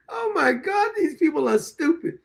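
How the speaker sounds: high hammed-up fake wail; badly faked weeping; mock sob. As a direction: pretend to cry to mock him. Mocking crying after laughing.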